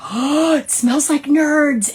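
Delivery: high, loud, drawn-out amazement; delighted discovery. Retold or imitated. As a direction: act out a thrilled realization, slowly. Retold delighted discovery of a smell.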